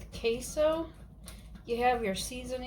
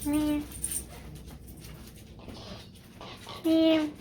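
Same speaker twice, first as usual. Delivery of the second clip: cat meow; 5 semitones higher, much slower than usual. Pretend. Calling the cat with meows.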